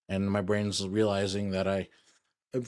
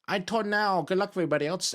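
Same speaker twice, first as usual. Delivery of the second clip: car voice; 9 semitones higher, faster than usual. Pretend.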